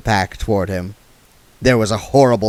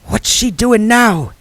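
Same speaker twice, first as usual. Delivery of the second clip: hissed; 12 semitones higher, 4 dB louder, slower than usual. Acted. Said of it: "hissed Nanny Ogg".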